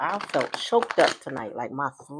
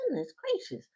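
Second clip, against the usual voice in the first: mock astonished gasp; 13 semitones higher, 7 dB quieter than usual. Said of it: "I'm like".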